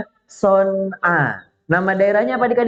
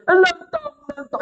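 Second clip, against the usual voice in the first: very high squealed cry, dropping away; comic falsetto plea for help; mock shouting. Storyteller plays soldiers fleeing in agony, squealed mock shout. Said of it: "Mereka sambil teriak".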